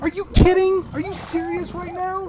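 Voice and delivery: very high, strained protest; incredulous outrage. Real, not acted. Player attacked in the game, incredulous outrage. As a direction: start loud, squeal in disbelief.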